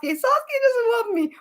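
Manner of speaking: high, whiny mock of a lovesick girl; high mock whine. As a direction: make fun of her moping. Mocking Sakura crying over Sasuke.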